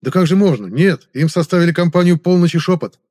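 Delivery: громко и высоко, с возражением; горячо разуверяй начальника; взволнованный подчинённый. A narrator's reading. Подчинённый возражает встревоженному наставнику. (взволнованно возражая)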